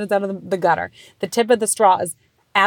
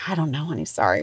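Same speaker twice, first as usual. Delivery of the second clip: soothing mom; 5 semitones lower, 5 dB quieter, faster than usual. Retold or imitated. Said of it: "I'm like".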